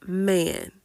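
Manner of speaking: usual speaking voice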